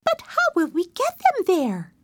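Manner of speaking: high, questioning voice; puzzled animal. Acted. Animal asks how.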